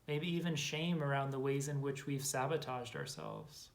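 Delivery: measured, calm inner-journey guidance with long silences; guided meditation. Acted. Guide to listeners exploring their inner saboteur, calm meditation voice. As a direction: invite quiet noticing, letting phrase endings settle softly.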